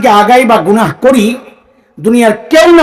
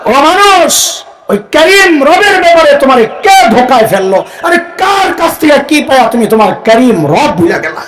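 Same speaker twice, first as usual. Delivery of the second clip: impassioned call; 4 semitones higher than usual. Acted. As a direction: cry out to man as if calling him back. Preacher voices God's call.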